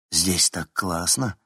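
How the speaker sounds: ordinary delivery, nothing marked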